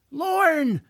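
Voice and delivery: high-pitched yell of a name. A narrator's reading. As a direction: yell out to get her attention. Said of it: "George yelled".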